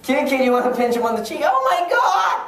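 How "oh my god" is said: high-pitched, hammed-up squeal; overwhelmed gushing. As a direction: squeal as if the cuteness is too much. Acted gushing over a cute kid.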